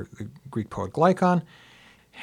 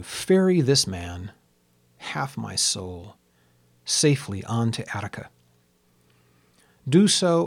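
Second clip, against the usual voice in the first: measured, declaimed verse with long pauses between phrases; stately poem recital, line by line; poetry reading. Acted. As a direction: recite an ancient ode in translation, lifting the start of each phrase. Reads own translation of the ode.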